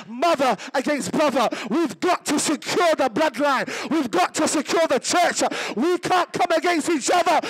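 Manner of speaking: loud rebuke of the devil; fiery preaching. Acted. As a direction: preach it, commanding the enemy.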